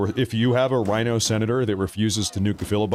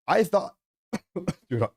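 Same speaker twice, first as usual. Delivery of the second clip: cracking, strained voice cutting out; losing voice; 8 semitones higher than usual. Real, not acted. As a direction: voice giving out mid-rant. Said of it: "I'm losing my voice".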